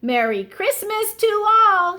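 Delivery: high, loud festive call; shouting. A narrator's reading. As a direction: call it out to everyone. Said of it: "shouted Froggy".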